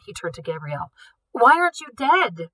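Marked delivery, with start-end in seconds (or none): 1.3-2.5 loud, higher accusing demand; shocked and furious director; angry demand. Acted. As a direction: bark the question at her. Director confronts Gabrielle.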